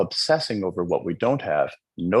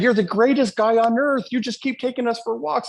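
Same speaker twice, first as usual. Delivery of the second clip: very high, squeaky voice of eager dogs; playful high-pitched pet voice; dogs' voice; 13 semitones higher than usual. Retold or imitated. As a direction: squeal it like a delighted dog. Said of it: "They just said".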